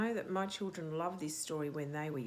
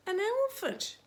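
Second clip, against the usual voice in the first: much higher and louder, big wow; excited reveal; 14 semitones higher, 6 dB louder than usual. Acted. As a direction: announce it like a huge surprise.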